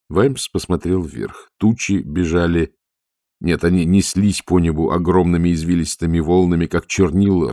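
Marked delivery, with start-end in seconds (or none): none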